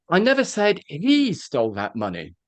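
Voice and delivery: "he" stretched out and lifted; heavy emphasis on "he", the accused person; stress on HE. Shown as a demonstration. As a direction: put the weight on "he". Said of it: "I'll say it now".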